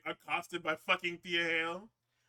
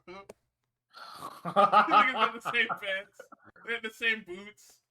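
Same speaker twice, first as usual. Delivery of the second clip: laughing; 6 semitones higher, 10 dB louder, much slower than usual. Real, not acted. Laughing at matching outfits.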